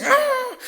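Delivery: high, drawn-out effort grunt; acted straining groan. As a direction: imitate a child heaving at a heavy stone. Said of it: "Entonces vuelve y hace". (quejido agudo de esfuerzo fingido)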